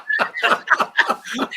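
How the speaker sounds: breathy burst of laughter; laughing. Real, not acted. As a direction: break into a laugh. Laughs after acted outburst.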